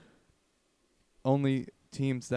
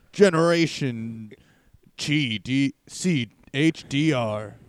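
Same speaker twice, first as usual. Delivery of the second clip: slow booming mock announcement; announcer voice; 9 dB louder, much slower than usual. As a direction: drag it out like a game show host.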